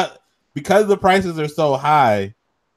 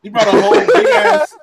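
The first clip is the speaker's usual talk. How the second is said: laughing while telling; 7 semitones higher than usual. Real, not acted. Laughing while retelling the sub.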